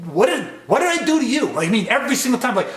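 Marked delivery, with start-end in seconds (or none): 0.1-1.5 sharp, raised accusation; agitated demand. Shown as a demonstration. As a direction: throw the question at them. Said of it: "It's like".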